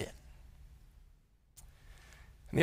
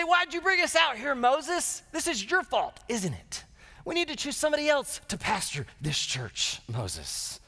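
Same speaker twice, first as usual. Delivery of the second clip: high, whiny blaming, hammed up; mock complaining crowd; 10 semitones higher than usual. Israelites blaming Moses, a high mock-whiny complaint. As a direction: grumble at Moses like a peeved church member. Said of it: "They say".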